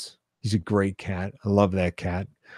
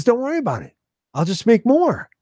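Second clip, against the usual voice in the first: carefree Carl; 13 semitones higher than usual. Retold or imitated. Carl answers breezily.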